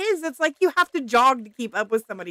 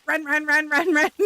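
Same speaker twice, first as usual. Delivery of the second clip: higher-pitched, rapid mimicry of short legs; hurried little scurry; 6 semitones higher, 4 dB louder than usual. Wife showing how she scrambles beside her husband, comic hurried scurry. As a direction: act out tiny hurried steps with your voice. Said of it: "I'm like this".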